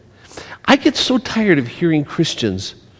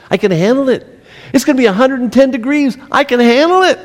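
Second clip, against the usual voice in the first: higher, louder, buoyant positive self-talk; upbeat can-do; 7 semitones higher, 5 dB louder than usual. Pretend. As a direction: proclaim it with joyful gusto.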